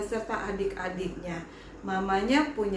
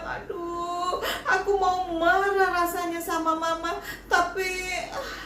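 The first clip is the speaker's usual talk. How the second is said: put-on hurt child; 6 semitones higher than usual. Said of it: "Eli begitu terluka".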